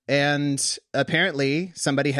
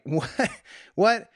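higher, baffled double take; mock bewilderment; 9 semitones higher than usual. Jesus, interrupted mid-teaching by a quibble, mock bewildered. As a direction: ask it rising, as if thrown by an interruption.

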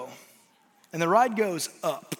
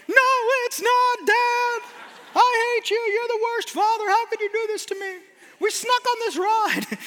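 screaming daughter; 12 semitones higher than usual. Retold or imitated. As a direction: wail at dad like a betrayed kid. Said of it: "she's screaming and yelling at me".

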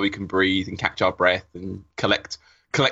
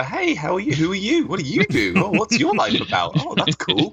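mock small talk; 8 semitones higher, 3 dB louder than usual. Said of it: "Maybe like a Midworld mixer".

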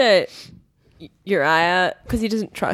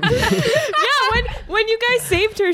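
talking through laughter; laughing; 13 semitones higher than usual. Real, not acted. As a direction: giggle through the words. Laughing while telling.

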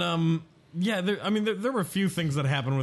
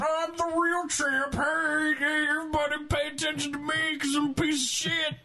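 megaphone Covington; 13 semitones higher than usual. Retold or imitated. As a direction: blare it like through a megaphone. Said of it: "I, I can do this".

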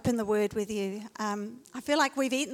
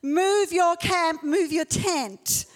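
slow, loud, higher warning; 6 semitones higher, 5 dB louder, much slower than usual. Retold or imitated. Pastor retelling David's warning, slow, loud and higher. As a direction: lay it down like a danger sign. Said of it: "putting up a danger sign saying".

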